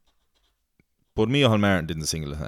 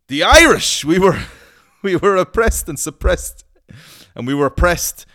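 much higher, loud laughing rant; 11 semitones higher, 10 dB louder than usual. Real, not acted. Mocking Irish oppression, loud laughing rant. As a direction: belt it out half laughing.

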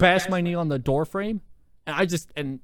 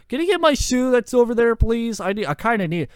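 high, louder mock plea; pleading; 8 semitones higher, 4 dB louder than usual. Fleeing storyteller asking classmate for the lost shoe, pleading. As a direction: beg urgently in a raised pitch, imitating yourself.

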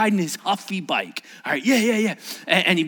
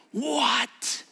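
high-pitched disbelief; a little girl's amazed question; acted astonishment; 9 semitones higher than usual.

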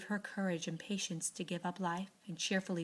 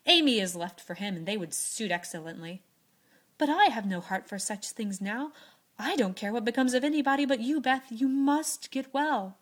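louder, urgent pleading; impassioned Joe; 3 semitones higher, 9 dB louder than usual. Acted. Joe urges dying Beth to recover, loud and urgent. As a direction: beg her to get well.

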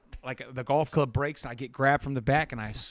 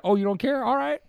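high mocking sneer; sarcastic; 10 semitones higher, 3 dB louder than usual. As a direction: taunt him, pitch up. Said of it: "John's like".

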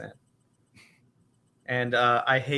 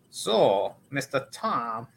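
slow, halting mock Chinese accent; slower than usual. Podcaster greets co-host Tom as a guest, mock Chinese accent. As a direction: imitate an old film actor's Chinese accent, measured pauses. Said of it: "the Chinese accent".

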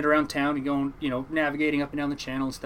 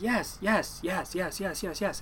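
imitating weary, higher-pitched repeated yeses; fed-up reassurance; 5 semitones higher, 4 dB quieter, much slower than usual. Nephew answers his uncle's endless asking, fed up. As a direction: brush off the nagging, retold. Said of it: "kinda sick and tired of him asking".

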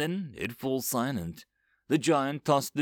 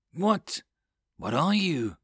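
stammering; 5 semitones higher, slower than usual. A narrator's reading. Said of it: "is all I can manage to stammer".

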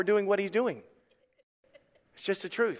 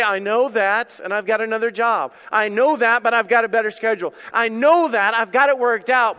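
God answering; 4 semitones higher, 7 dB louder than usual. Acted. Preacher voicing God's calm answer.

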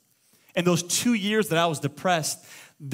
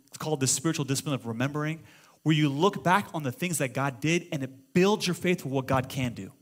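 ordinary delivery

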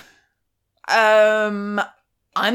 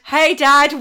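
louder, awkward greeting to dad; awkward hey dad; 3 semitones higher, 7 dB louder than usual. Retold or imitated. To her date's passing dad, an awkward call. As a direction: wave it off, embarrassed. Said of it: "I've done the awkward".

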